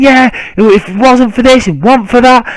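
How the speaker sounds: high-pitched mock indignation; imitating irate fan. Retelling a rival fan's excuses in an argument, mock irate. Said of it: "he can see his eyes about to explode".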